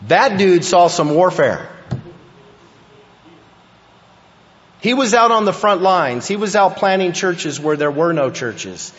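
fervent preaching. Real, not acted. Preaching about Paul's warfare.

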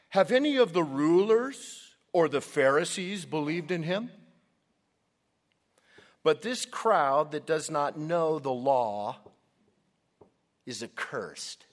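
loud, indignant Pharisee scorn, slowing toward the end; arrogant. Acted. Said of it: "notice the arrogance of these men".

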